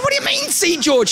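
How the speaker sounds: high-pitched exasperated disbelief; incredulous protest. Retold or imitated. Student questioning the name book, high incredulous protest. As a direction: pitch it right up in dismay.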